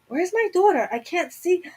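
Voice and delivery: a mother scared she's gone blind; frantic panic. Retold or imitated. Retold panic, can't see daughter.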